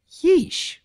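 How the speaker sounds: very high, loud squeaked yeesh. A narrator's reading. Bon Bon sees the grumpy villagers and squeaks yeesh. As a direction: squeak it out in dismay. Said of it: "Bon Bon's voice squeaked".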